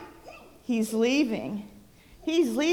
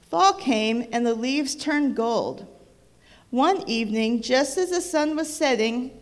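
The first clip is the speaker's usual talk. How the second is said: storybook reading voice, lively; reading to children; 5 dB louder than usual. A narrator's reading. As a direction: read it aloud like a bedtime book. Reads picture book to child.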